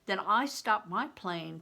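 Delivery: usual speaking voice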